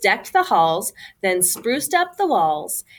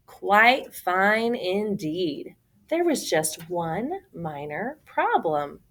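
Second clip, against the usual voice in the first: plain reading voice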